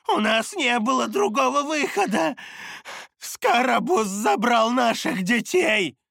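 put-on high, anguished old man's plea; desperate pleading. A narrator's reading. Old man explains his stolen children to the demon, begging desperately. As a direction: plead desperately, pitched right up. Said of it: "взмолился Халерун".

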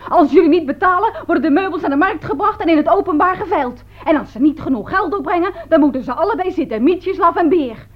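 high, pompous mock proclamation; hammed-up officious announcement; fat bailiff's threat. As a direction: imitate a bullying official laying down the law. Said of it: "toen zei die dikke".

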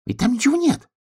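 very high squeaked protest; shocked. A narrator's reading. Hedgehog pointing at where the sky was, shocked squeak. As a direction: squeak it in bewildered dismay.

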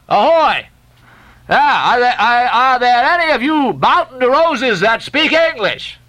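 high, slow bawling across the water; bawled hail. A narrator's reading. Stubb bawling a hail to the French ship. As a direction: hail the ship at the top of your lungs. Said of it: "he bawled".

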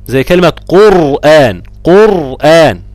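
قرآن said loud with a heavy, thick R; tafkhim example. Shown as a demonstration. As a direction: stress قرآن, R full and emphatic. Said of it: "أو سكنت بعد ضم".

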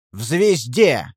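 slow, drawn-out irritated answer; spiteful emphasis on each word; angry retort. A narrator's reading. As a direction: throw back a sour, exasperated reply. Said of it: "зло ответил ему".

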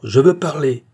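high, loud demand to be heard; stentorian shout. A narrator's reading. Butcher silencing the mob, shouting loudly. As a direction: shout over a crowd. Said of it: "une voix de stentor".

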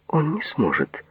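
high-pitched but restrained exclamation. A narrator's reading. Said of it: "воскликнула Нора". (негромкое восклицание высоким голосом)

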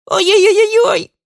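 put-on very high, loud child's yowl; ear-splitting wail. A narrator's reading. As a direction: wail "oy" over and over, shrieking. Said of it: "От вопля у Мити засвербело в ушах".

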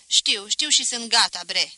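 loud, brisk cut-off; café keeper cutting off the boy, loud and brisk; impatient reply. Acted. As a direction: wave him off, already ready. Voap cuts the boy off.

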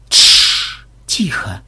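urgent hush. Acted. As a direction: shush them, then drop the voice. Hushing a companion in story.

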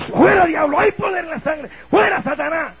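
loud, high exorcist shout; rebuking shout. Retold or imitated. Preacher rebuking the approaching madman as Satan, shouting. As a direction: cast the devil out with full force.

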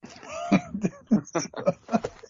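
high laugh bursting out at the comparison; burst of laughter. Real, not acted. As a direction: crack up at the joke.

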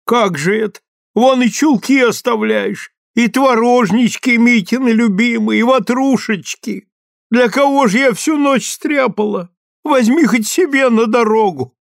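high, loud fussing granny; fretting old woman. Acted. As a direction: fuss and fret like a granny. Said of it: "разахалась".

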